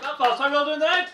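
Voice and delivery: loud, high call to the whole room; big stage greeting, projected; crowd-rousing shout. Real, not acted. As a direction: hype up the audience.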